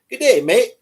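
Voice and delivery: high, loud Aussie greeting; Australian accent. Acted. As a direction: call out in a put-on Australian accent. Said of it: "I can do an accent".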